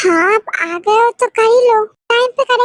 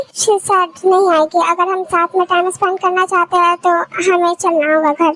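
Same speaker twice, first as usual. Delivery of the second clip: normal talking voice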